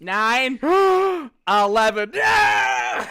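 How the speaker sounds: hammed-up loud cartoon gag; mock Simpsons shout. Redoing a cartoon bit for friends, loud mock shout.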